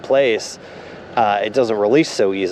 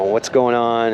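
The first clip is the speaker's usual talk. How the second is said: plain reading voice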